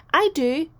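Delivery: high, loud eager "I do"; eager volunteer. Acted. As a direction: jump at the chance, loud and high.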